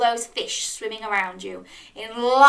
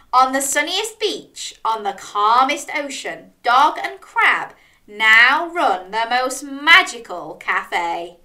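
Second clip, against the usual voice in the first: measured, emphatic storybook ending; children's story narration; 5 dB louder, slower than usual. A narrator's reading. Storyteller reads the happy ending, savouring it. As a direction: build up the key words for young listeners.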